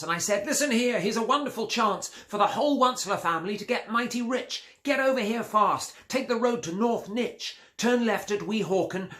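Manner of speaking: high, fast, eager calling; whipped-up hustle, slowing at the end; excited sales pitch. Acted. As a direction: rush the family over with glee. Excited call to family.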